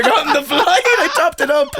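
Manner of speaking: can barely get it out for laughing; wheezy high laughing admission. Real, not acted. Laughing at himself.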